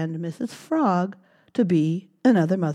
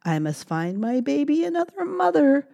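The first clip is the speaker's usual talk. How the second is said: mother mouse; 8 semitones higher than usual. Acted. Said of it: "said Mother Mouse".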